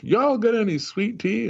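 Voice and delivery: mock the Georgia guy ordering in a Boston pub; Southern Georgia accent. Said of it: "Austin's like".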